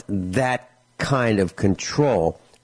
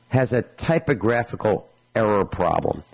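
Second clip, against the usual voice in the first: usual speaking voice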